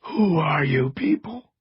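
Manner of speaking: strained, slow raspy voice. A narrator's reading. Injured man confronts strangers in his room, raspy. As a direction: rasp it out with effort. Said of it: "Cross rasped from the screen".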